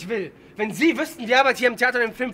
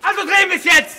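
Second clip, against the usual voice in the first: shouted order, higher and louder; berserk rage; 5 semitones higher, 5 dB louder than usual. Real, not acted. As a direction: bark the command. Actor berating director on set.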